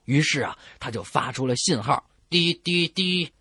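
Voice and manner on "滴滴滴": slow mechanical beeping; imitated signal beeps. Warship signalling the ship ahead, slow beeps. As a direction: tap out a radio signal.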